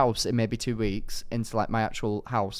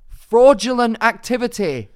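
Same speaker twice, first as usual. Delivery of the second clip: high, loud comic indignation; mock outrage; 11 semitones higher, 12 dB louder than usual. Complaining that beds come without mattresses, in loud mock outrage. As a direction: declare it loudly like a scandal.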